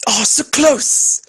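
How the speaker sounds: high, loud, drawn-out near-miss cry; excited dismay. Real, not acted. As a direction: wail it slowly, breaking into laughter after. Reacting to a narrowly failed roll.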